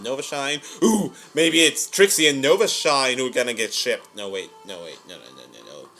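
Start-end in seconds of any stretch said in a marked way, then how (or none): 4.1-5.9 low, very soft string of denials; backpedalling mutter. Real, not acted. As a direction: take it back under your breath. Backs off own shipping theory.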